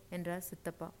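usual speaking voice

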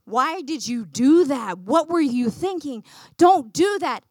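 loud, emphatic mock telling-off; scolding parent. Parent scolding a child for misbehaving, loud and stern. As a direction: come down hard on each question.

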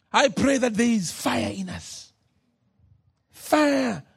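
ordinary delivery

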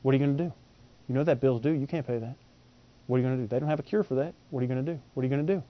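low, soft, nagging taunt repeated; devil's taunt. Retold or imitated. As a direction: whisper doubt into their ear like the devil. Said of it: "here's a mantra".

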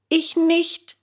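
put-on high, earnest boy's insistence; protesting. Bengele firmly insists to the gendarme he didn't do it. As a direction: insist on innocence. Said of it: "beteuerte Bengele".

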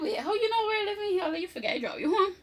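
high, fast, startled disbelief; shocked surprise. Retold or imitated. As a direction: blurt it out in alarm. Shocked to see him outside.

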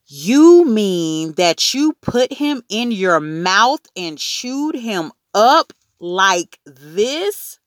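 slow, high, loud disbelief; horrified disbelief. A narrator's reading. Mother asking Fudge if he chewed the turtle, incredulous. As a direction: draw out each step, appalled.

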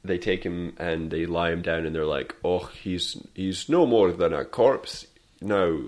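ordinary delivery

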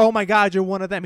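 loud pretend panic at being caught; mock freak-out. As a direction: imitate someone freaking out. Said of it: "so he started freaking out".

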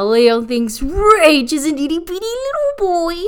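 high, cooing mock baby talk; babyish teasing. Teasing that Rage is seen as tiny, babyish coo.

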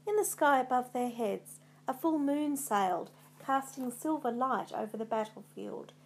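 plain reading voice

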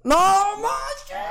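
very high held shocked cry; astonished squeal. Real, not acted. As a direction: squeal in disbelief at good news. Teacher passes him anyway. (grito agudo de incredulidad)

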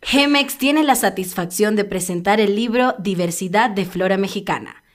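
measured promo read in neutral Spanish; neutral accent. Shown as a demonstration. Showing friends her voice-over accent, neutral Spanish read. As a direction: read it formally in a neutral Latin American accent. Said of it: "Vamos a ver si lo hago bien".

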